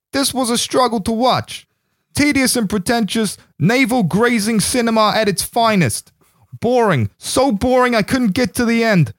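high, hammed-up East Coast American man's accent; bad East Coast accent. Reading a listener's review aloud in a mangled East Coast accent, on a cohost's dare. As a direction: ham up a generic East Coast guy. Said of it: "just massacred their accent there".

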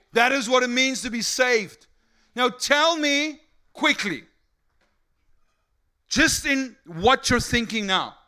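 loud, high, fervent sermon delivery; impassioned preaching. Real, not acted. Preacher telling congregation about shared joy, loud fervent preaching. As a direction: drive each phrase home to the congregation.

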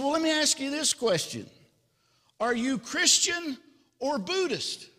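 high-pitched, keen acted questions; eager questioning. Rescuer quizzing the jumper on religion, high and eager.

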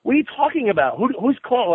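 high-pitched, incredulous outburst; frantic disbelief. Retold or imitated. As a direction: act out panicked questioning, pitch shooting up. Acts out his frantic questioning.

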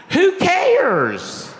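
high, drawn-out exasperated cry; loud mock exasperation; exasperated dismissal. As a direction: throw it out high and impatient, imitating a blunt friend. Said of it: "he said it just like that".

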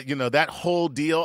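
normal talking voice

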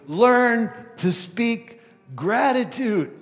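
loud, high, ringing sermon voice; fiery pulpit delivery at the top of the voice; full-force preaching. Acted. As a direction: preach it out to the whole room. Preaching climax.

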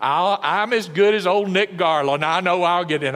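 arrogant boast. Pretend. Proud churchgoer bragging at judgment, arrogant. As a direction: brag like you're sure to get in. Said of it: "with all their arrogance".

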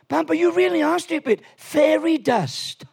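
put-on high-pitched, impatient child, slowing on the last words; exasperated granddaughter. Granddaughter explaining the obvious to her grandpa, mock exasperation. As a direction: imitate a little girl scolding, drawing out the answer. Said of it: "are you stupid?".

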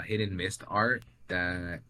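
ordinary delivery

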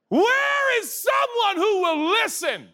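hammed-up desperate call into the void; desperate cry. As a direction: mock-desperate yell.

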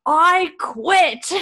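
high, loud indignant squawk; hammed-up high-pitched outrage; mock outraged Jordy. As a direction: squeal it out like a scandalized shopkeeper. Jordy's outburst in retold scene.

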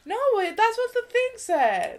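high, loud, quick protest; excited protest. Real, not acted. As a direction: insist loudly, defensive. Defending herself, loud.

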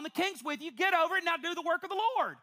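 ordinary talk

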